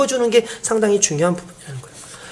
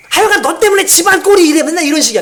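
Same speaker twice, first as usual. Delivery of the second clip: high-pitched mock nagging at first; blaming mother; 6 semitones higher, 4 dB louder than usual. Mother blaming an upset child, acted angry nagging. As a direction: act out an exasperated mom's blame.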